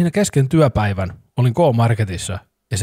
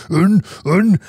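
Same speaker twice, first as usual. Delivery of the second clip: put-on high, louder refusing child; a stubborn kid shouting no; pitched-up toddler refusal; defiant toddler; 7 semitones higher, 4 dB louder than usual.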